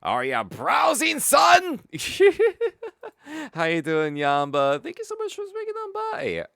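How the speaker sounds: loud, high hammed-up shopkeeper shout; shopkeeper greeting. Streamer playing a shouting shopkeeper greeting the customer, hammed up. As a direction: bellow a welcome like a pushy merchant.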